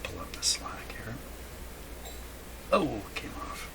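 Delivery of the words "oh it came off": ordinary talk, nothing marked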